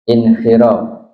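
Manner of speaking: normal talking voice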